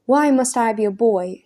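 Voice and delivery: plain reading voice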